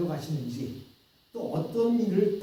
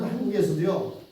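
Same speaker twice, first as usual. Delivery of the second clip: usual speaking voice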